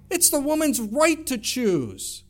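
sneering mimicry of the world's line; mocking retort. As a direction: parrot their slogan back with scorn. Voicing the world's pro-choice retort.

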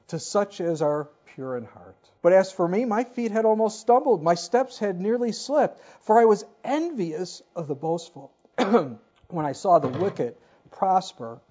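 measured, earnest reading of a psalm; scripture reading. Acted. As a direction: read the verses like a pulpit lesson. Reads the psalm aloud.

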